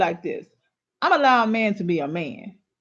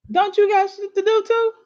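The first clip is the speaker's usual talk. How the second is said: much higher, louder, exasperated; indignant outburst; 12 semitones higher, 4 dB louder than usual. Real, not acted. Speaker scolding women who excuse their man, high and loud. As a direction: fire it back in disbelief.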